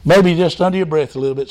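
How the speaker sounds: ordinary delivery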